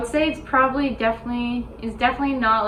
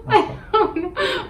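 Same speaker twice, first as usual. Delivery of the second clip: laughing; 12 semitones higher, 5 dB louder, slower than usual. Real, not acted. Laughs at the German word.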